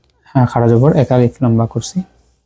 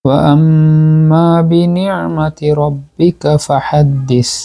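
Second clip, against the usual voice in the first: slow, drawn-out melodic recitation; Quran recitation; 3 semitones higher, much slower than usual. Acted. Closing a recited surah for students, Quran recitation. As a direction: chant it with long held syllables.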